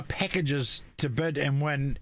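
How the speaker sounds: usual speaking voice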